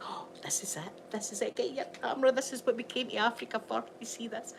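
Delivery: hushed, thrilled whisper; excited whisper. Retold or imitated. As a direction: whisper it like the big moment is coming. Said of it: "everybody in the car would all be dead excited".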